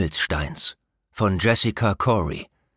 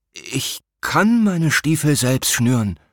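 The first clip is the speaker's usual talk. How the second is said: tense prince; 6 semitones higher, 4 dB louder, slower than usual. A narrator's reading. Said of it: "und er murmelte".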